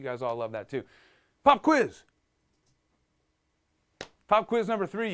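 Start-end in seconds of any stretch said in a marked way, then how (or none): 1.4-2.0 high, loud announcement; calling out. Real, not acted. Teacher announcing a quiz to students, high loud call. 4.3-5.1 raised, high-pitched announcement; announcing. Real, not acted. Teacher announcing the last quiz, raised high call.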